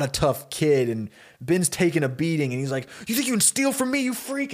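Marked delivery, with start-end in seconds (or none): 3.1-4.5 high, strained outburst; mock-furious teen, pitch way up without shouting; acted fury. Teenager punching the kid who robbed him, furious.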